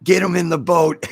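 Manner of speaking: comic shouted order; mock shouted order. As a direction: yell it like a boat captain in a flood. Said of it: "like I said".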